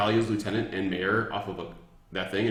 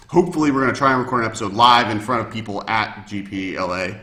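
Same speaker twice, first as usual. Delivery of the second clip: louder, upbeat host sign-off; stream outro; 8 dB louder than usual. Acted. Host signing off to people on the stream, upbeat announcer read. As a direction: wrap up the show for the listeners.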